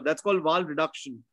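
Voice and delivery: ordinary talk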